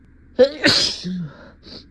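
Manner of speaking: loud hacking cough. Acted. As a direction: cough hard. Allergy fit.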